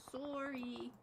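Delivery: soft, drawn-out Canadian "sore-ee"; Canadian accent. Shown as a demonstration. Caster apologizing to viewer Henry, mock Canadian accent. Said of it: "That sound Canadian enough?".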